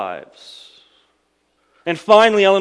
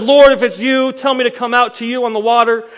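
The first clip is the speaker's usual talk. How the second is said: much higher and louder, bursting with eagerness; eager call; 9 semitones higher, 9 dB louder than usual. Acted. Impetuous Peter calling out to Jesus across the water, eager. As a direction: call out without thinking, all enthusiasm.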